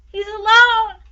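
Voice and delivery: high, loud despairing scream; screaming mother. A narrator's reading. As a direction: scream in despair, the child is gone. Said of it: "the mother screamed".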